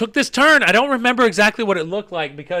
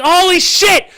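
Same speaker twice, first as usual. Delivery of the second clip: high, loud exclamation of alarm; mock panicked cry; 10 semitones higher, 5 dB louder, slower than usual. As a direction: re-enact shock at the wheel. Re-enacts panic as cars pass.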